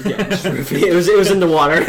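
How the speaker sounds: giggling out a joke; laughing. Real, not acted. Friend laughing while telling the drugged-water joke. Joking sarcastically, laughing through it.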